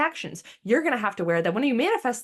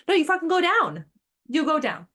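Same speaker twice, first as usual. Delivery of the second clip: much higher and louder, blunt; emphatic; 8 semitones higher, 4 dB louder than usual. Real, not acted. Telling listeners bluntly, higher and louder. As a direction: shout the drop at them.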